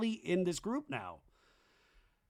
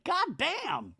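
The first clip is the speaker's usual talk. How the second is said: high-pitched exclamation; mock exasperation; 12 semitones higher than usual. Jasmine telling everybody to chill out, high mock exasperation. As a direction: squeal it in hammed-up exasperation.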